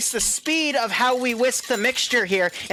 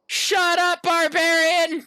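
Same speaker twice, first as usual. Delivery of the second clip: slow mock shout; 9 semitones higher, much slower than usual. Real, not acted. Host yelling at cohost Barbarian after another joke, slow mock shout. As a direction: drag out the name in a mock yell.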